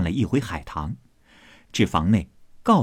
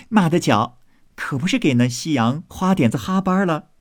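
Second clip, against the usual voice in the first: chuckle, then higher amused voice; laughing teasing; 6 semitones higher than usual. Acted. Maids all teasing a friend, amused and laughing. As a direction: open on a short laugh and tease. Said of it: "众人听了都笑道".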